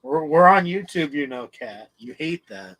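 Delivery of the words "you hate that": usual speaking voice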